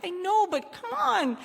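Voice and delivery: high, wheedling plea; whiny pleading. Retold or imitated. Begging Heidi to stay at the bar, whiny. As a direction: beg like a kid who wants to stay up.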